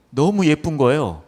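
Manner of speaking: ordinary delivery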